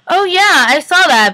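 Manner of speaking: high, loud feigned nonchalance; overdone casual agreement; playing it cool. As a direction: act innocently casual, a bit too brightly. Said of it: "Try to play it out all good".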